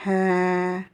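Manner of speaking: H said as a long, held "hae"; prolonged vowel. Shown as a demonstration. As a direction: breathe out H and stretch the vowel. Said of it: "Et prolongation".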